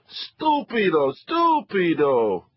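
high, slow Italian scold of stupido; Italian mother. Acted. Mother scolding her sons, slow and Italian. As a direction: wag a finger with each stupido.

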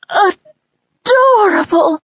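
slow, high, loud gushing; adoring sigh. Acted. Mother owl gushing over her hatchling. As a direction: stretch it out, melting with delight. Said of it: "Soren's mother sighed".

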